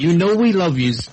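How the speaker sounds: high, loud playful pirate voice. Pretend. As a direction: ham up a pirate catchphrase. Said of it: "say it like a pirate".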